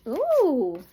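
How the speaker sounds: loud, high, long ooh; amazed ooh. Real, not acted. Reader reacting to the card just drawn, amazed ooh. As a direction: draw out a surprised, high-pitched ooh.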